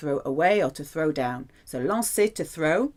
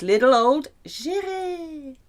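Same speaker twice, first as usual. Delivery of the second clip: cutesy mouse voice; 8 semitones higher, slower than usual. Acted. Character mouse introduced.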